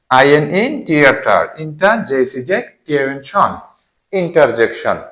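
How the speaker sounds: rhythmic letter names, each followed by its syllable; spelling by syllable. Shown as a demonstration. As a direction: spell each chunk, then join as interjection. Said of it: "syllable করে".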